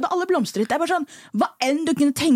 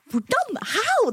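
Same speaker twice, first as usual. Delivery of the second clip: higher, wondering; amazed disbelief; 11 semitones higher, much slower than usual. Retold or imitated. Telling her friend how she marvels at life, higher and wondering. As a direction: marvel at your own luck. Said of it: "så er jeg sånn".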